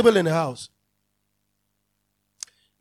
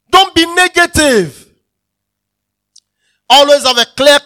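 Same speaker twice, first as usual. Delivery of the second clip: forceful preaching; 4 semitones higher, 10 dB louder than usual. Real, not acted. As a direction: hammer each command home.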